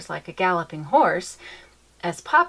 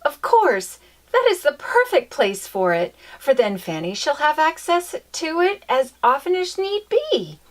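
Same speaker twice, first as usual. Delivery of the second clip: eager; 8 semitones higher, 5 dB louder than usual. Acted. Mamma continues her eager cry.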